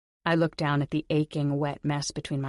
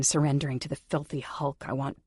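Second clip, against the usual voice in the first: usual speaking voice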